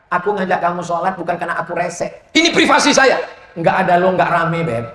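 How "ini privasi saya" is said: high, louder defiant snap; mock defiant. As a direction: defend privacy in mock anger. Husband retorts.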